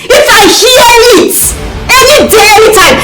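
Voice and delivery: high, heated warning; shouted threat. Acted. Threatening the woman over her man, angry shout. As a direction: pitch the threat up in fury.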